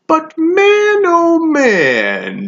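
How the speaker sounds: very high swooping relish; unhinged, loud high exclamation; gleeful menace. Acted. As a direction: burst out high, then slide down. Savage relishing a bite.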